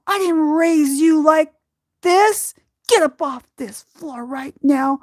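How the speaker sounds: high, loud, slow tough-love scolding; a mother ordering her grieving daughter off the floor; fierce mom. Retold or imitated. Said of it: "there's usually a cuss word".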